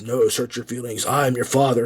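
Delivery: a hint of low Vader voice; mildly deepened Vader line; Darth Vader. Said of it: "And he goes".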